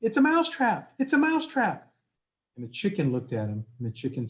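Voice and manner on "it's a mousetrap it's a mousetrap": high, frantic little mouse cry; panicked mouse yell. Acted. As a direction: shout it like a terrified tiny mouse. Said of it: "ran back to the farmhouse yelling".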